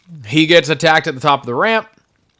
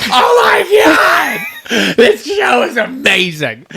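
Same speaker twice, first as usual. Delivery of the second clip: much higher, slow laughing shout of joy; ecstatic shout; 9 semitones higher, slower than usual. Retold or imitated. Fan retelling his own reaction, shouting with laughing joy. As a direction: yell with delight through laughter. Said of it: "and I was like".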